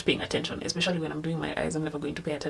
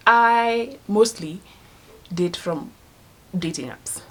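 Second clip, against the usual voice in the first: ordinary talk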